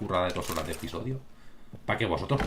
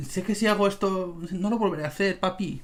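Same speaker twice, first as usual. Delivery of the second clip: cat talking to owner; 9 semitones higher than usual. Pretend. Imagined cat speaking to owner.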